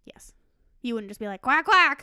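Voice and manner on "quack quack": duck quack. Retold or imitated. Mocking just saying the sound.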